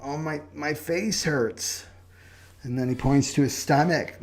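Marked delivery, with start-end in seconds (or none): 0.0-1.8 slow, lightly voiced moaning patient; a hint of a self-pitying patient groaning to his doctor; whiny patient. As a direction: drag out the aches like a mock sufferer. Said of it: "and he goes".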